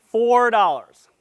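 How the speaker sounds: loud, high and drawn out; emphatic repeat. Real, not acted. Presenter repeating the card's cost to the audience, slow and emphatic. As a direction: stretch out the price loudly for effect.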